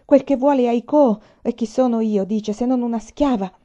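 loud, indignant complaint; angry. A narrator's reading. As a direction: report the order with open anger. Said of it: "con voce bassa e irosa".

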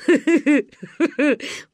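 loud rolling laugh; roaring laugh. Acted. Uncle Misha bursts out laughing at his daughter. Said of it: "покатился со смеху дядя Миша".

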